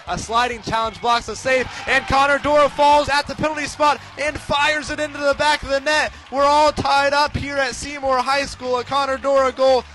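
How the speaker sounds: building from plain to a loud shout; excited goal call. Real, not acted. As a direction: climb with the play into the goal.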